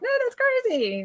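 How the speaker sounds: high incredulous. Retold or imitated. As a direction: brush it off as crazy. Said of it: "every time I'm like".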